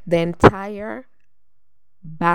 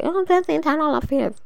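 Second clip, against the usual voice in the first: mocking quote; 10 semitones higher than usual. Mocking what everybody says.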